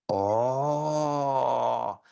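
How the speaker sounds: long held, even "oh"; drawn-out demo vowel, steady pitch; unaspirated vowel sound. Shown as a demonstration. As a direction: sustain a smooth "oh" without h. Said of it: "E se não tivesse o tio".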